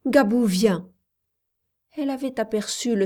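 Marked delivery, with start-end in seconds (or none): 0.1-0.9 put-on child's exclamation; Mayotte. A narrator's reading. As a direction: cry it out, a little louder. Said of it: "s'écria Mayotte". (exclamation d'enfant)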